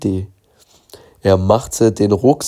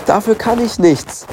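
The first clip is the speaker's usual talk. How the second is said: despairing; 11 semitones higher than usual. Acted. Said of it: "sagte die Verkäuferin verzweifelt".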